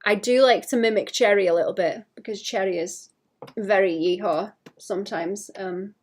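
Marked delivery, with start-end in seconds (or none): none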